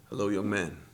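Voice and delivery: low, hushed imitation of a strange man; creepy stranger. Imitating a strange coworker greeting him, low and hushed.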